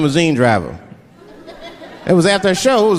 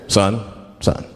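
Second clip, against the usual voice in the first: much lower, stern police officer; cop voice; 11 semitones lower than usual. Retold or imitated. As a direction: lean in like a traffic cop. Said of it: "the police pull me over".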